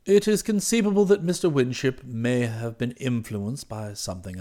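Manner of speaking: low, soft, circumspect valet; composed valet Jeeves. Acted. Valet owning up to his employer, guarded and formal. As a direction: admit it discreetly with unruffled formality.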